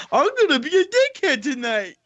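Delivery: high-pitched caricature of a bar jerk; mock obnoxious jerk. Co-host mocks the stranger looking for trouble, high goofy jerk voice. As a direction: crow it like a proud troublemaker.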